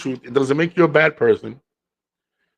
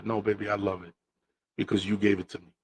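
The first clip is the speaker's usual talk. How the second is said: softly soothing, a touch tender; soft reassuring; 3 semitones lower, 8 dB quieter than usual. Retold or imitated. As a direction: gently comfort her, softer. Soothing the upset wife.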